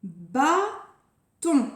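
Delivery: "Bâton" slowly split in two beats; Bâton with a clear gap before the last syllable; separated syllables. Shown as a demonstration. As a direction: say bâ, pause, ton for "Bâton". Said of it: "Il y a deux syllabes".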